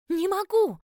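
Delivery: high, loud panicked squeal; squealed cry. A narrator's reading. Heroine being pulled away from captain, squealing in protest. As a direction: shriek it out helplessly. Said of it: "взвизгнула я".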